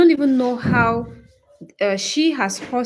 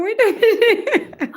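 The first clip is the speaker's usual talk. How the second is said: high, loud, laughing words; 12 semitones higher, 5 dB louder, slower than usual. Real, not acted. Streamer joking with viewers about her reputation, high loud laughing. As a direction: laugh through the words, pitched right up.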